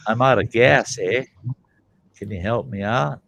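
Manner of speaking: a light U.P. eh tacked on; Yooper accent. Retold or imitated. Stranded driver blending in with locals, light Yooper eh. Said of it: "you gotta get the A in there".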